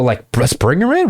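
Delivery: ordinary delivery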